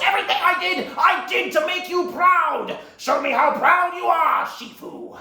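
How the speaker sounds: high, loud, drawn-out shouting; theatrical wounded rage; anguished fury. Acted. Villain shouts at the master he wanted to impress, anguished. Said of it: "he goes".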